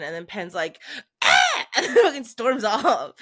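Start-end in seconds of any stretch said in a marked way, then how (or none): 1.2-1.4 a short, loud, mock cry of frustration; frustrated yell. Pen blowing up at the team, short mock yell. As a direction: blurt a sharp "Ah!" like a fed-up friend. Pen storms off in retold scene.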